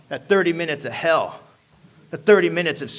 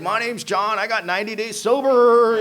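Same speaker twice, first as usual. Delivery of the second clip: higher, louder proud intro; cheery AA newcomer; 6 semitones higher, 7 dB louder than usual. Retold or imitated. Man on a walker introducing himself, mock proud. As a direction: announce it proudly.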